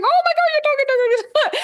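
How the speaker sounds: high, frantic mock panic; panicked pleading. Runner to dog owner while the dog charges, high frantic panic. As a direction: squeal at the owner to grab her dog.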